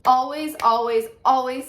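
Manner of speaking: each "always" hit loud and high; emphatic repetition. Real, not acted. Coach urging teachers to celebrate their wins, emphatically. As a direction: punch every "always" with force. Emphatic repeated advice to viewers.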